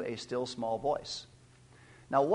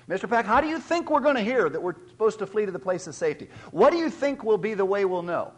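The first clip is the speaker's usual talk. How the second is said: higher, louder, fast worried question; anxious congregant; 7 semitones higher, 5 dB louder, faster than usual. Retold or imitated. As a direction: imitate an eager church member asking. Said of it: "I have heard people say".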